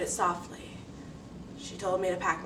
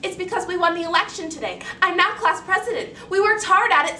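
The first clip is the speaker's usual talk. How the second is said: put-on high, loud, quick proud child; proud eager girl; 9 semitones higher, 11 dB louder than usual. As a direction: boast eagerly to your father. Girl proudly defends herself.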